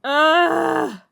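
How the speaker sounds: loud, high groan; big loud emotional groan; overwhelmed groan. Real, not acted. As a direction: groan it out, overcome with feeling. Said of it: "My eyes are tearing".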